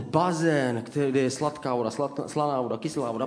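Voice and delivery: usual speaking voice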